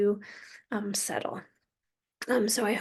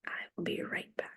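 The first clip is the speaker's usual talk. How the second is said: hushed aside; 13 dB quieter, much faster than usual. Real, not acted. Steps away mid-recording.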